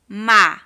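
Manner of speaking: Ma said loud and alone; syllable beat. Shown as a demonstration. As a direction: hit the syllable Ma clearly. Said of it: "Qual vai ser a primeira sílaba?".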